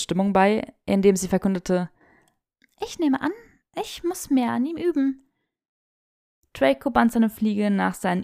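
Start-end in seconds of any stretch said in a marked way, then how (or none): 2.8-5.2 put-on high, fast protesting young woman; a flustered girl caught out; flustered Hermine. A narrator's reading. Hermine, caught off guard by a test, high flustered protest.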